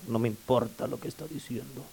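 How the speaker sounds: low, very soft dismissive aside; quietly imitated brush-off; imitated indifference. Voicing an indifferent listener's silent look.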